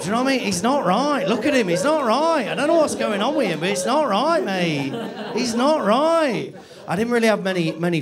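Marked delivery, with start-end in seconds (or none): 0.5-6.5 mock local bloke. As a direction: play a gossiping local pointing someone out. Mocking Nottingham locals' phrase.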